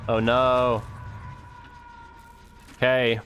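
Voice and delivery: ordinary talk, nothing marked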